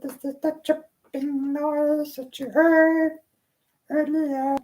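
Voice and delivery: high squeaky critter voice; squeaky little creature. Acted. Small creature introduces itself to the midwife, high and squeaky. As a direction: talk like a tiny chirping creature.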